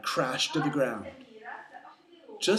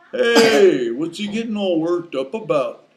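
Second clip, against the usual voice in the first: loud, drawn-out moose voice; moose Took; 4 semitones higher, 12 dB louder than usual. Acted. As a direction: call out as a puzzled moose. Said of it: "said the moose named Took".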